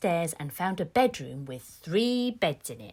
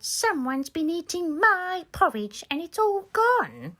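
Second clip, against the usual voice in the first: put-on higher, small baby bear voice; 8 semitones higher than usual. Baby Bear finds his porridge bowl empty, high little voice. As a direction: squeak it out like a little cub upset at losing his breakfast. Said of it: "Baby Bear picked up his bowl and said".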